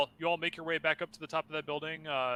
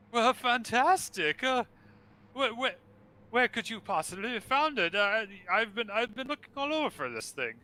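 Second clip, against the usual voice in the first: high, grand, theatrical ghostly host; flaming skull voice; 10 semitones higher, 6 dB louder than usual. Acted. Skull delighted by the find.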